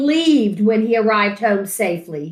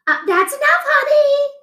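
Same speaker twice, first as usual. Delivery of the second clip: mother voice; faster than usual. Acted. Mother urges husband.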